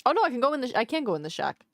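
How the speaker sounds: ordinary delivery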